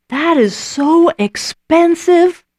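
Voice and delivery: high, slow, indignant scoff; scoffing disciple. Retold or imitated. Disciple scoffing at wasted perfume, slow high indignation. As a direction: sneer at the waste.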